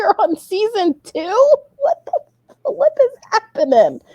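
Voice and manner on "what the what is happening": high, astonished squeal; mock disbelief. Real, not acted. Mom reacting to her son racing through the show, in high mock disbelief.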